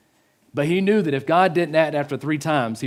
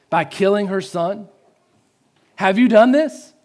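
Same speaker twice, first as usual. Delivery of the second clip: raised, rising challenge to God; anguished questioning; 5 semitones higher, 4 dB louder than usual. Retold or imitated. Elijah asks God about killing her son, anguished. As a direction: cry out the question, higher and louder. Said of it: "he cried to the Lord".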